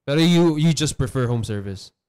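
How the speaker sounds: normal talking voice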